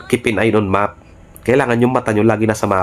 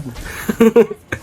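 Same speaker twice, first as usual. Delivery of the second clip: laugh; 9 semitones higher than usual. Real, not acted. After taunting enemy.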